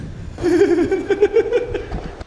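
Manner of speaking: loud high laughter; laughing loudly at his own story; hearty laugh. Real, not acted. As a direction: burst out laughing.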